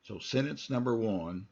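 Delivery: ordinary talk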